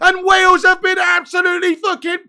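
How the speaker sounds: rising from eager to full goal shout; building goal yell. Real, not acted. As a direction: build the hype until you roar the goal. Iran attack, then score again.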